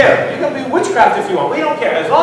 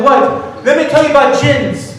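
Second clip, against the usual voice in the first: eager pitch; 6 semitones higher, 3 dB louder than usual. Retold or imitated. Brother pushing jinn talk.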